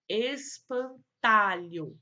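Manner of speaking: "espantalho" stretched out slowly in syllables; slow dictation. Shown as a demonstration. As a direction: draw out "espantalho" for pupils writing it. Teacher dictates word to write.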